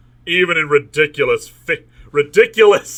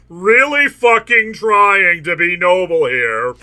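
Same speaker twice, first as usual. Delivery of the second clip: louder, higher, drawn-out insistence; exasperated; 5 semitones higher, 5 dB louder than usual. A narrator's reading. Hero protesting his noble efforts, exasperated. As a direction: push the words out in frustration.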